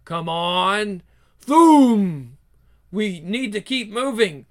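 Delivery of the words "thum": loud voiced thunder crack; thunder crack sound. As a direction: thump it out like thunder.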